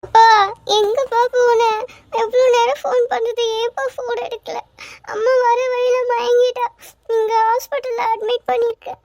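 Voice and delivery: high, crying plea to dad; a girl crying to her father about her mother; tearful. Acted. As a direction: sob it out to her father on the phone. Daughter crying to father on phone.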